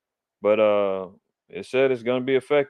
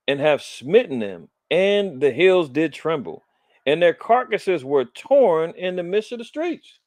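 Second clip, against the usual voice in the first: scripture reading; 3 semitones higher, 4 dB louder than usual. Acted. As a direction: read the prophecy like a preacher. Reads scripture aloud.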